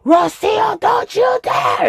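high, slow shouted warning; mother yelling a warning at Rocio; mom's warning shout. Retold or imitated. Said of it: "And Mommy was like".

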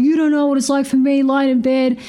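high, fast mock whine back; retold retort. Partner's retort mocked to listeners, high and fast. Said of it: "And he's like".

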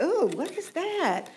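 high, slow puzzled ooh; a light retold alarm. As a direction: wonder what that sound is. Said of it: "And I was just like".

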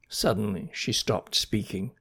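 ordinary delivery

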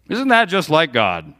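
usual speaking voice

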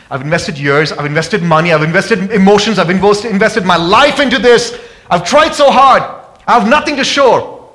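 from steady to loud, high cry; building preacher's lament. Acted. Preacher voicing a worn-out believer, building to a cry. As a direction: climb to a desperate shout.

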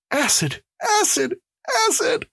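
high, climbing cries of dread; gasped in terror. Acted. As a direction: cry each word out in high panic. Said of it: "gasped the prisoner".

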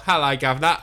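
a quick hammed-up Cockney hello; jokey stock Cockney accent. Shown as a demonstration. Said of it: "I could go the old".

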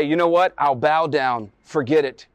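usual speaking voice

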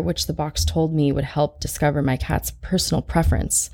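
ordinary delivery, nothing marked

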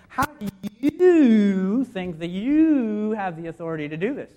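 slow, leaning on "you" with the weight at the end; slow, accusing "you have the authority"; stress on YOU. Shown as a demonstration. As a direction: drag it out and press "you" hard. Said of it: "the pronoun you is actually the last word".

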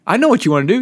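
ordinary talk, nothing marked

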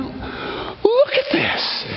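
high, slow, unvoiced gasp of wonder; breathless awe. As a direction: breathe it out, stunned. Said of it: "I mean".

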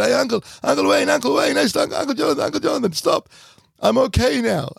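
put-on high child's voice, calling out through tears; a small boy begging his uncle to stop; crying little boy. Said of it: "I was crying".

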